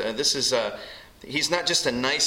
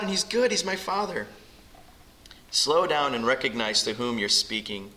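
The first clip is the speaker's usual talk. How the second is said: usual speaking voice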